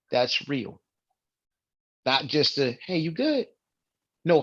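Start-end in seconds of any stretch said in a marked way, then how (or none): 2.9-3.5 high, breezy greeting; offhand and pitched up; casual check-in. Acted. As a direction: toss out a light, passing check on a friend. Mimics a shallow check-in.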